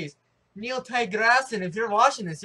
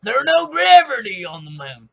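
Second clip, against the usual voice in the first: loud, higher, dumb-guy voice spitting out a wrong fact; mocking the guy; 4 semitones higher, 14 dB louder than usual. Gamer mocking the Texan he argued with, loud and dumb-sounding. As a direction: lampoon his confident nonsense, loud. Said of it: "He's like".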